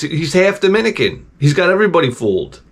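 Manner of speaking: usual speaking voice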